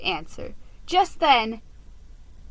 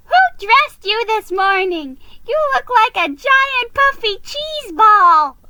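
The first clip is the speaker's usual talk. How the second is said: high, loud mocking squeal; teasing shriek; 12 semitones higher, 10 dB louder than usual. A narrator's reading. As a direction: hoot at the cheese-ball outfit. Thea mocking Geronimo's outfit.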